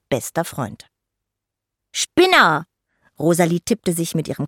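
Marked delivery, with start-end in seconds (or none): 1.9-2.7 high, loud mock indignation; indignant little fairy, loud and high; teasing insult. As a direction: throw out a playful jeer. Fairy mocks her friend.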